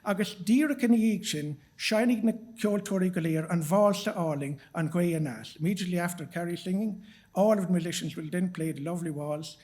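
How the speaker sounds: usual speaking voice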